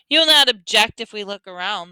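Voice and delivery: loud, high, harsh demand; harsh soldier. A narrator's reading. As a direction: make the question an order. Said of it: "The voice was harsh".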